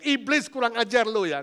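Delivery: loud, indignant telling-off; acted angry yell. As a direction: yell an insult at the devil. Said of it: "Nggak pernah saudara teriak".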